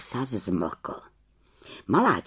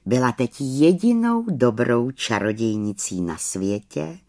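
usual speaking voice

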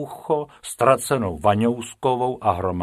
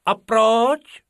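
put-on high, loud childlike question; curious, squeaky-high little character; curious Bobek; 13 semitones higher, 7 dB louder than usual. A narrator's reading. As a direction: pipe up with an inquisitive why. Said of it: "zeptal se zvědavý Bobek".

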